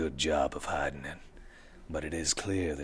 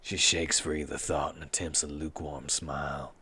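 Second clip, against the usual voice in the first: ordinary delivery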